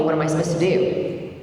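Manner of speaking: ordinary talk, nothing marked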